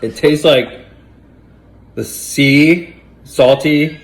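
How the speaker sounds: loud, disgusted groaning; gagging on the awful taste. Real, not acted. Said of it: "it's so gross".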